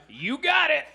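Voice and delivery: hammed-up cheer. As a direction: point and cheer it out. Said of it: "I just went".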